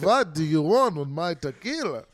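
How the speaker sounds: put-on hammy vampire-style Transylvanian accent; bad Transylvanian accent. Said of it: "You just sound like a vampire from Transylvania".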